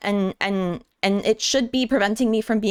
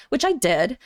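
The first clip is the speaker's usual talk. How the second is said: ordinary talk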